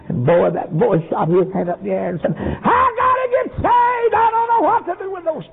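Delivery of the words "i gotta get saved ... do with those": high, slow anguished scream; boy crying out to be saved. Retold or imitated. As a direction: scream it out in desperation. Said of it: "when he screamed like that".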